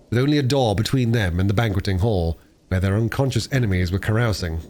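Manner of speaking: usual speaking voice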